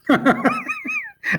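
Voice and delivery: a short real laugh at his own story; light chuckle; amused laugh. Real, not acted.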